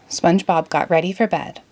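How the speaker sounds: ordinary delivery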